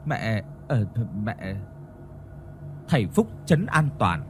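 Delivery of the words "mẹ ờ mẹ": softer, faltering mumble; guilty stammer. Acted. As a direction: caught out, can't find words. Mother stammers, caught out.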